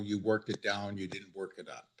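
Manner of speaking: ordinary delivery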